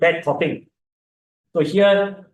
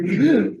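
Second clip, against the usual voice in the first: sharp gasp; 6 semitones higher than usual. Shown as a demonstration. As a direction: suck the air in fast with a gasp.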